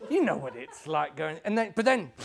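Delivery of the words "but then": plain reading voice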